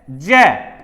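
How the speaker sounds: ज्ञ read as gyay; जय spoken gyay, the g and y together. Shown as a demonstration. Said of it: "इसको जय बोलते हैं".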